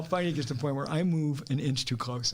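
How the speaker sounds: ordinary talk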